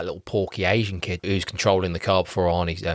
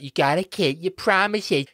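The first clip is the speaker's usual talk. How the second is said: whiny Jamie; 9 semitones higher, slower than usual. Retold or imitated.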